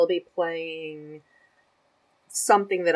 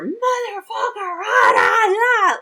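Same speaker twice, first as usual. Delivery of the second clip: high, loud raging outburst; imitated gamer rage; 8 dB louder, slower than usual. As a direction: yell like a gamer losing it. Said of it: "like this".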